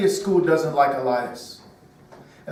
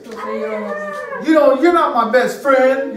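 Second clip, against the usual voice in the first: put-on a higher, louder childish pout; pouting little boy; 6 semitones higher, 5 dB louder, much slower than usual. Angry little son to daddy, childish pout. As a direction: sulk at daddy like a cross toddler. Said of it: "what do you think he says".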